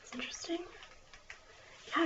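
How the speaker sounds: usual speaking voice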